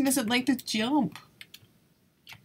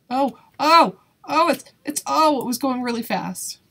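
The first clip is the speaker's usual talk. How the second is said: sudden loud startled cry, higher; 3 semitones higher, 8 dB louder than usual. Real, not acted. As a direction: caught off guard, loud and high. Startled by fast obstacle.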